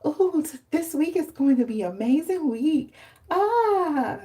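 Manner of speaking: high, sing-song optimism; mock cheerful. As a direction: gush it like a naive morning person. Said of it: "I was like".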